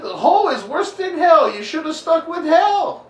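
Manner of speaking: very high, loud comic dad rant; mock outraged yelling. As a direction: shriek it in exaggerated disbelief. Father's comic outburst at his son.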